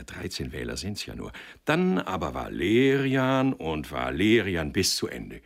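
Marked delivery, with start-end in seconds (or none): 0.0-1.3 low, soft aside; inner thought. A narrator's reading. Sender checking his own tally, thought in an undertone. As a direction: reckon it privately under the story. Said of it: "dachte er".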